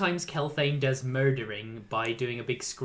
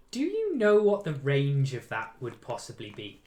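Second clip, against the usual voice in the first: ordinary talk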